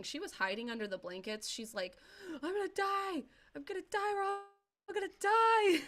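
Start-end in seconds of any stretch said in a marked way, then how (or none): 2.3-5.8 much higher mock shriek, slowing and louder on the last line; hammed-up horror-movie panic, very high; screaming in terror. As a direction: imitate the terrified friend under the blankets. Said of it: "And she was screaming".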